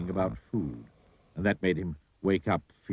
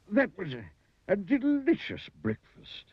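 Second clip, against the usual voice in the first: Mister Greedy's voice; 10 semitones higher than usual. A narrator's reading. Greedy eater sums up his breakfast, high and savouring. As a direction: relish it in a high little voice.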